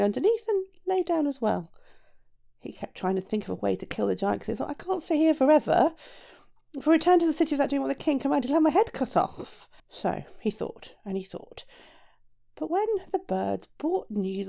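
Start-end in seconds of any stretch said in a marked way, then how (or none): 4.7-9.7 higher, lightly worried inner voice; a hint of fretting, pitched up; worried shoemaker. A narrator's reading. Shoemaker thinking about the king's command, mildly worried.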